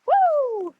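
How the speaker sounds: high, loud whoop at the cold; startled whoop. Real, not acted. Wading into cold water, startled loud whoop. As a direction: yelp as the cold hits.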